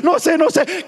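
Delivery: high, loud, hammed-up frantic wail; mock tearful panic. Husband phoning the pastor about disaster, imitated frantic wailing. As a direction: blurt it out like a desperate caller. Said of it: "me llamó el hombre llorando".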